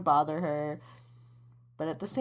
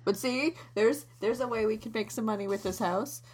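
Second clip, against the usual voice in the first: ordinary talk, nothing marked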